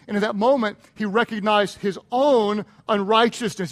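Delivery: ordinary delivery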